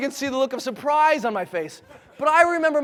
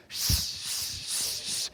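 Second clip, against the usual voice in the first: long unvoiced rushing hiss; wind noise; 9 dB quieter, much slower than usual. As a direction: imitate roaring wind with a long shh. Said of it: "what you hear is the wind".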